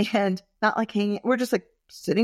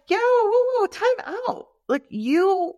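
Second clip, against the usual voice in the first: startled whoa; 12 semitones higher than usual. Retold or imitated. To listeners, retelling her reply to the ex's nasty text: high startled whoa. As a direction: throw up your hands and call time out in disbelief.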